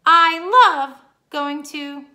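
loud, slow excited start. Shown as a demonstration. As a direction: stretch it out with excitement. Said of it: "She's excited".

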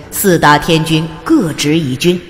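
plain reading voice

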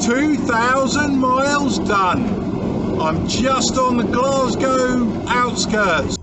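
loud, high and slow, savouring every word; triumphant update. Real, not acted. Driver telling viewers he's near Glasgow, loud, high and slow. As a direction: announce it like a victory lap.

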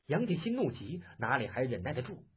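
plain reading voice

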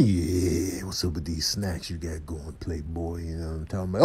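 quiet, low sly sweet-talk; smooth-talking character. Pretend. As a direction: murmur it low and take your time. Same character eyeing snacks.

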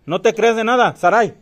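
loud high call into the dark. Real, not acted. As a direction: shout her name from afar. Calling out to the friend.

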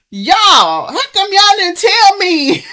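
loud, high-pitched complaint; indignant shouting. Real, not acted. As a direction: yell accusingly at your audience. Scolds viewers for not warning her.

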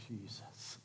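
breathed out almost without voice; hushed recognition. Acted. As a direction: whisper the name as it dawns on her. Mary recognizes the risen Jesus.

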